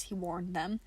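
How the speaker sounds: ordinary delivery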